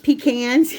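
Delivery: "Pecans" said pee-CANS, a as in cat. Shown as a demonstration. Baker echoing another way of saying it, "Pecans" as pee-CANS. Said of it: "yeah, that's the other".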